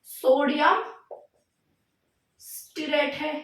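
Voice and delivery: name broken up by a long pause; dictation. Real, not acted. Teacher repeats the salt's name for students, spaced-out dictation. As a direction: say it piece by piece for writing down.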